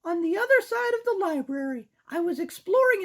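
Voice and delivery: put-on squeaky girl mouse; high-pitched cartoon mouse. Voicing Sarah.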